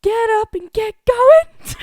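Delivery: high, loud, drawn-out call; alarm yell. Pretend. Co-host finishing the alarm for listeners, hollering. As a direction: holler it slowly to rouse a sleeper. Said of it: "Clip this for your alarm".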